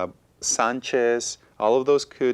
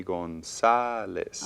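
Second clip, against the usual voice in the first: "González" slowly, leaning on the middle SA; stress on SA; 2 semitones lower, much slower than usual. Shown as a demonstration. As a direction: stretch gon-SA-les to show natural stress. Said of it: "it should be stressed on the".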